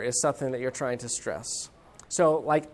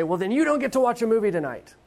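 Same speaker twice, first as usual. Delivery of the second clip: put-on higher, louder angry snap at the child; mock freak-out; 7 semitones higher, 4 dB louder than usual. Dad losing temper over messy room, mock angry outburst. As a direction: lose it at the kid. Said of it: "lose my temper and freak out and say".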